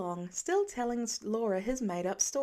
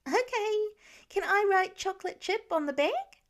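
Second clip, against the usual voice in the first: put-on high, louder excited little boy; a kid perking right back up; brightened child; 9 semitones higher, 5 dB louder than usual. A narrator's reading. Nate asking Laura about the bag, high and thrilled. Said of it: "Nate brightened again".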